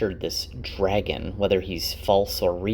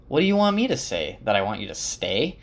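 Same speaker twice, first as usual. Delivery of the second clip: mild retold anger; faster than usual. Man angry with himself answering his partner, mildly exasperated. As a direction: throw it back in mock exasperation. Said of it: "there's anger in his voice".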